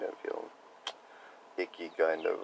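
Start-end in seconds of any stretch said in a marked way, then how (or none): none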